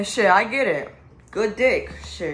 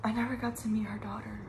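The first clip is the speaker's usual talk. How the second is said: whisper; 3 semitones higher, 10 dB quieter, faster than usual. Real, not acted. Grieving, voice drops to whisper.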